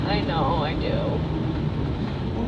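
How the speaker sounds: soft low admission; sheepish quiet. Real, not acted. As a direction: mumble it, embarrassed. Admitting he cries.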